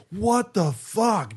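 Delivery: very high, slow, drawn-out "what the fuck"; stunned disbelief. Retold or imitated. Rob hearing the singer's demo, high slow disbelief. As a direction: stretch it out way up high in shock. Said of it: "Rob's like".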